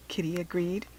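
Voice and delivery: usual speaking voice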